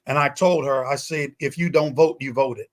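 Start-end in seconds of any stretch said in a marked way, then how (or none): none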